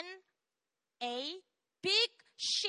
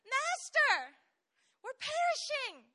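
high, loud panicked calling; desperate cry; 12 semitones higher, 5 dB louder, slower than usual. Acted. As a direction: cry out for the master in fear. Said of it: "this is what he says".

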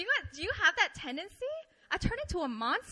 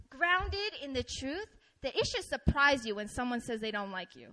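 ordinary talk, nothing marked

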